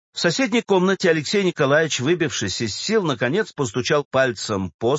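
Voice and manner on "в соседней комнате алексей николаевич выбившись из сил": usual speaking voice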